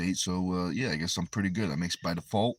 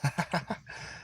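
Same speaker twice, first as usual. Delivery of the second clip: quick breathy giggle; amused chuckle; 10 semitones higher, 5 dB quieter than usual. Real, not acted. Reacting to the chat bot, quiet chuckle. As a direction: laugh under your breath in a quick run.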